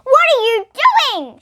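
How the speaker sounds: high, loud alarmed shout. Acted. Macy catching Denver flushing things, shouting. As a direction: catch him in the act. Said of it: "shouted Macy".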